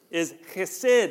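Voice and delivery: chesed with a throaty kh at the start; Hebrew chesed. Shown as a demonstration. Pastor teaching the church a Hebrew word, throaty kh. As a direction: say chesed with a rasping kh, like clearing the throat. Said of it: "that little noise there".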